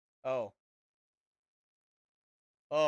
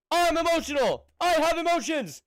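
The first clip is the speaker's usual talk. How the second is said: much higher and louder, hammed-up declaration; mock outburst; 11 semitones higher, 6 dB louder than usual. Streamer owning his tears to chat, hammed-up loud. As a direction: proclaim it like a big confession. Playful outburst defending crying.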